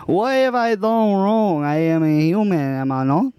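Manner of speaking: light put-on Spanish accent. Improv scene as Juan.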